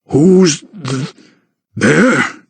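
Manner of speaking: startled giant, mouth full of bread; sputtering through bread. A narrator's reading. Giant startled while eating, sputtering. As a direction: blurt it out mid-chew. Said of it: "he sputtered through a mouthful of bread".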